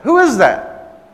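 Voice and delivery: loud, high-pitched challenge; indignant demand. Retold or imitated. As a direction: imitate an outraged woman demanding an answer. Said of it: "That's how she said it".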